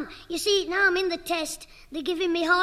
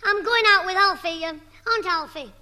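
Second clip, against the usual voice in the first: ordinary talk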